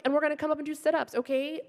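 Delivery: fast, high-pitched trainer instructions; quick, bubbly gym coach. Retold or imitated. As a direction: rattle it off like a peppy trainer.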